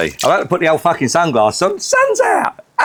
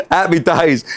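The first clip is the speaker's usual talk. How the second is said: ordinary talk, nothing marked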